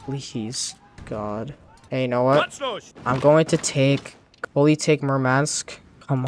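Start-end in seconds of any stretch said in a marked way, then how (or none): none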